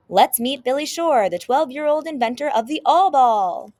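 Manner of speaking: big, loud host introduction; TV show announcer. Acted. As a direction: announce the guest to a studio audience.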